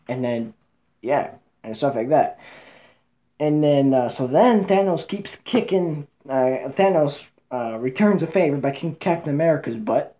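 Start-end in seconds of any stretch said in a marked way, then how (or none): none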